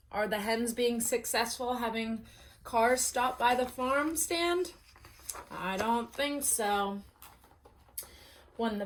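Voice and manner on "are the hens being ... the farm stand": ordinary delivery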